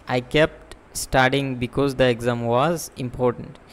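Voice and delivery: very slow model sentence; deliberate slow example. Shown as a demonstration. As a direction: spell the example out slowly. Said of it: "উদাহরণ এখানে দিছি আমি".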